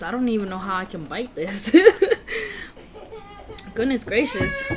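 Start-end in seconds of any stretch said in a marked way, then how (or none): 1.7-4.7 high, squealing laugh. Real, not acted. Laughing at huge bite.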